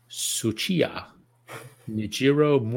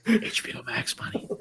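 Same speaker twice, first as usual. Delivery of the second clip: whisper; 10 semitones higher, 7 dB quieter than usual. Pretend. Whispered sponsor plea.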